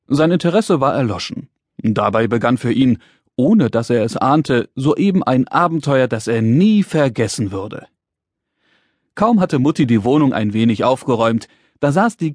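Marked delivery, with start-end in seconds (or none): none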